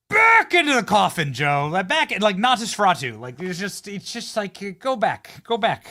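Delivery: high, loud shooing cry; mock banishing shout. Host banishing Joe to his coffin like Nosferatu, mock shouting. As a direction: drive him back like a vampire.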